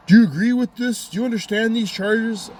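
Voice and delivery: high-pitched mock official questioning; imitated court official. Court asks defendant about the charges, mock official.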